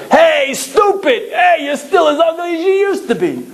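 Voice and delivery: high, jeering yell across the street with a laugh; taunting shout. Retold or imitated. Old schoolmate shouting to husband across the street, high jeering yell. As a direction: bellow the insult like an old buddy. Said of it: "somebody from across the street go".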